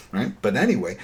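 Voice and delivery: ordinary talk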